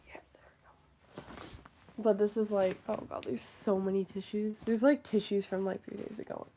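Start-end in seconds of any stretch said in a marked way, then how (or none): 0.1-1.2 whisper. Real, not acted. Settling down after getting up.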